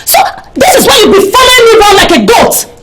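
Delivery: fast, heated shout; outraged and scornful; angry accusation. Acted. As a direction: hurl the accusation at them. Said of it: "don't sound like that".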